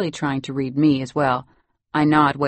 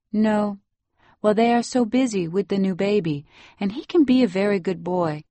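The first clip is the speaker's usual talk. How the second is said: put-on higher Salvadoran-accented voice; 5 semitones higher than usual. A narrator's reading. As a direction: defend the boy in Salvadoran-accented English. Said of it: "She takes little breaths as she speaks".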